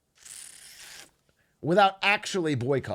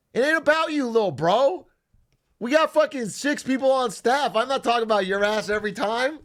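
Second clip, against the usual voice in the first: mock scolding retort; 8 semitones higher, 3 dB louder than usual. Retorting to editor, raised.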